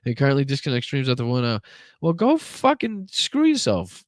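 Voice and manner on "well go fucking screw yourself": much higher, slow, drawn-out curse; angry retort. Real, not acted. Streamer cursing Instagram for ending the stream, angry. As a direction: spit it at the screen.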